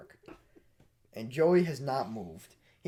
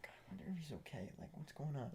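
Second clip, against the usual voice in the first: very soft, hurried murmur; hushed wondering; 21 dB quieter, much faster than usual. Retold or imitated. Friends listening at the silent bathroom, hushed and puzzled. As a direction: murmur it quickly under your breath.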